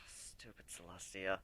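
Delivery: soft, slow mutter after a nightmare; low annoyed mutter on waking; muttered under breath. A narrator's reading. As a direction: grumble it quietly to yourself. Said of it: "I muttered under my breath".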